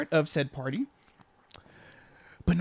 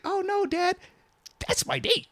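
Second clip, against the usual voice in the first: much higher mock teen girl voice; daughter; 13 semitones higher than usual. Dad imitating his daughter introducing her date. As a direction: whine it like an embarrassed daughter. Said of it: "This year it's like".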